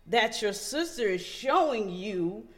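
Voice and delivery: loud, laughing preacher fire; preaching. Acted. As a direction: preach it to the crowd. Preaching about overflowing love.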